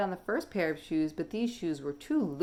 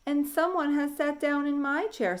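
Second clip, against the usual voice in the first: higher, calm mama dinosaur; 9 semitones higher than usual. Acted. Mama noticing her chair used, calm. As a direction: say it evenly, unruffled. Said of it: "said the mama in her calm voice".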